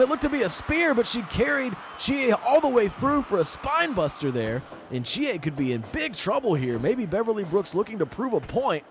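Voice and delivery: rising excited play-by-play; wrestling commentator. Acted. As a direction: call the big move, getting hyped. Commentator rises with the action.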